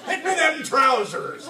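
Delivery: high, loud pirate captain's command; shouted order. A narrator's reading. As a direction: bellow it out excitedly as an order. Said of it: "he ordered".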